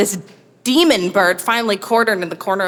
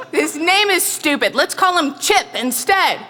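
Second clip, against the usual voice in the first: higher, laughing goofy husband; teasing husband; 9 semitones higher than usual. Retold or imitated. Husband renaming the peacock, laughing tease. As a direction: grin through the silly plan.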